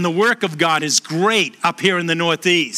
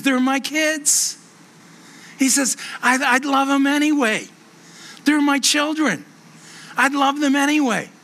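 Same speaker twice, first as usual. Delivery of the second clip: a hint of a dying father insisting; lightly voiced old man; 6 semitones higher than usual. Old man insisting to the chaplain, higher pleading voice.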